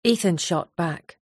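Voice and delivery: ordinary delivery, nothing marked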